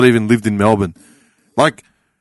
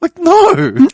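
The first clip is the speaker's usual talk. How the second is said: high, exasperated "no"; indignant refusal; 3 dB louder than usual. Retold or imitated. Retelling his answer to another fee, high indignant refusal. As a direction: refuse in pitched-up mock outrage.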